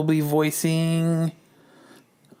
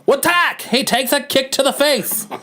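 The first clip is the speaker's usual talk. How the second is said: put-on high-pitched old-time narrator reading the scene; old-timey narration; 8 semitones higher than usual. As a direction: keep the raised narrator voice going through the action. Narrates the comic in a voice.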